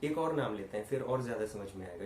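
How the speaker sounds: ordinary delivery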